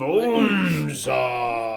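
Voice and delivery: slow, drawn-out imitated shout; wrestler bellow. Joining in on Bonesaw's name, mock bellow. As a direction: echo the wrestler's roar, stretched out.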